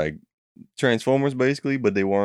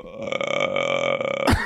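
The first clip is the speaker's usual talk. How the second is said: a much lower, sleepy mock grunt; groggy grunt; 14 semitones lower than usual. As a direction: grunt like someone who won't wake up. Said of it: "You were like".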